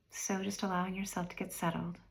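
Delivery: guided meditation. Real, not acted. Meditation guide inviting listeners to review the year, unhurried and calm. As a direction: guide them gently inward, leaving space after each phrase.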